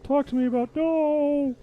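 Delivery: high, eager goofy voice; squeaky and excited, full of ideas; silly character voice. Pretend.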